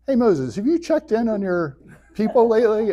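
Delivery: a lightly voiced laid-back God; casual God. God nudges Moses about his people, casual and offhand. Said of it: "God's like".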